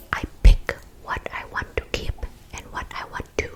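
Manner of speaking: usual speaking voice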